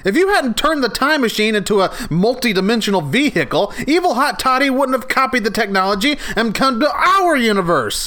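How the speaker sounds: high whiny blame; upset. Acted. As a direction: complain at the top of your voice. Blaming Ollie, upset.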